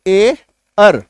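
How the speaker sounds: ए, pause, अर; separated sounds. Shown as a demonstration. Teacher recapping the taught sound, separated sounds. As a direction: say ए and अर as two detached sounds. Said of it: "जो मैंने आपको बताया कि".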